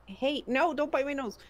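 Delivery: sharp no to a pet; scolding. Real, not acted. Scolding the cat biting.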